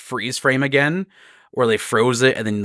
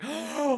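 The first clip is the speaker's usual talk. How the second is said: gasp; 10 dB quieter than usual. Retold or imitated.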